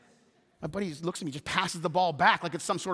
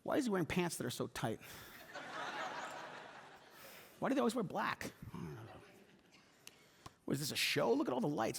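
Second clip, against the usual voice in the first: muttering critic; 12 dB quieter than usual. Retold or imitated. Critical churchgoer grumbles.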